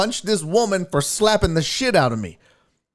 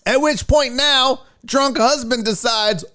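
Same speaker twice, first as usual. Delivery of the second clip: usual speaking voice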